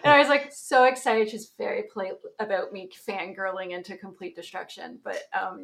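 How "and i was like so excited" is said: higher and louder, gushing; gushing excitement. Real, not acted. Telling the host about meeting her idol, excited. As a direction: bubble over with excitement.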